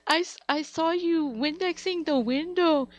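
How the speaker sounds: high, loud mom imitation; teasing mom. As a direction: crow it like a gleefully mocking mother. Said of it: "My mom's like".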